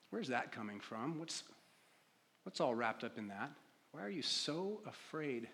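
very soft, low, with long pauses; hushed reflection. Real, not acted. Preacher inviting congregation to sit with a question, hushed. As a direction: invite them quietly to sit with it.

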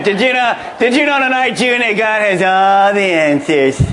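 playfully overdone excited announcement; mock excitement. Shown as a demonstration. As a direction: gush it in a put-on voice, stretching the words. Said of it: "say it with a little excitement".

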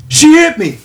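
high, loud mock cry of a man playing the victim; fake victim wail. Man on train after punching girlfriend, loud high fake-victim wail. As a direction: whine it out like the wronged one. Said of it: "playing a total victim".

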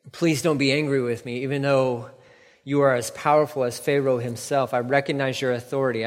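ordinary talk

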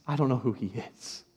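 Peter's third denial, crushed; quiet broken denial. Acted. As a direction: let the denial sink to almost nothing. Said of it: "Jesus says".

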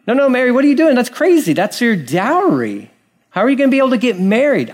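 high, incredulous scolding; scolding onlookers. Retold or imitated. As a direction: play the scandalised bystander, voice up high.

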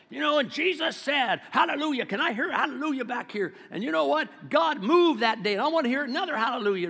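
high, loud hammed-up preacher shout for hallelujahs; mock Pentecostal yell. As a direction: work the crowd up like a revival preacher. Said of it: "we have to yell".

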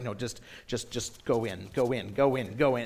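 low, hushed pleading to himself; quietly will someone to move along; anxious inner plea. Retold or imitated. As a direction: mutter it under your breath, low. Silently willing passenger to pass.

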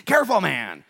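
loud, urgent caution; strong warning. Acted. Preacher voices God cautioning Joshua about accursed things, strong warning. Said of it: "he gave him the strong warning".

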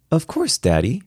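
put-on high child's voice, matter-of-course; stating the obvious. A narrator's reading. Daughter answering Daddy's foolish question, as if obvious. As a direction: answer as if the question were silly. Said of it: "as if I'd asked a foolishly obvious question".

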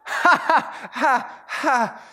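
high, forced mock laughter; fake polite laugh. Pastor imitating a corner-seat diner laughing along blindly, forced laugh. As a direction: laugh along without having heard the joke. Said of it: "you spend the whole meal going".

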